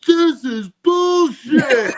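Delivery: high, loud, drawn-out mock-tearful protest; imitated tearful outrage. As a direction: yell it slowly like an outraged fan near tears. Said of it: "on the verge of tears".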